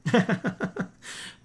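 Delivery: short real laugh, high; a quick chuckle at the joke; amused laugh. Real, not acted. Host laughing at the coach's Liverpool remark, amused laugh.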